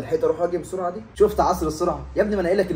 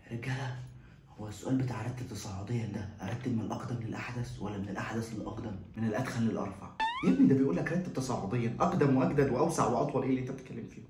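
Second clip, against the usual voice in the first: much softer, lower whispering; hushed exam whisper; 5 semitones lower, 7 dB quieter than usual. Acted. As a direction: keep it hushed so the proctor won't hear.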